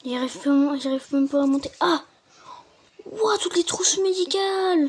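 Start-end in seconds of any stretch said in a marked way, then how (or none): 1.8-4.9 amazed. Real, not acted. Amazed at the medical kits.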